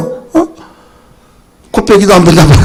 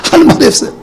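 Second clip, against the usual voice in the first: ordinary talk, nothing marked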